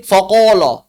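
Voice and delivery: faqala said with every vowel rounded to o; over-thick faqala. Shown as a demonstration. Teacher shows a heavy, rounded faqala. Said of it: "Jadi baca gini".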